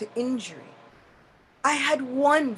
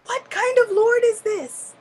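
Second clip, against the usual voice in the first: indignant question; 10 semitones higher, 5 dB louder than usual. Acted. Indra's mock-indignant complaint.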